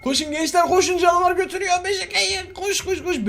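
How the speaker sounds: very high laughing shout to run; giggling high-pitched "koş koş koş"; frantic laughing yell. Real, not acted. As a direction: scream at the team to run, cracking up. Urging teammates to chase monster.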